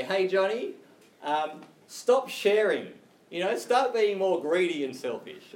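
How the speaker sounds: put-on loud, high parent calling to a child; mock parent. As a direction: lay down the law like a parent, tongue in cheek. Mock parent urging child to misbehave.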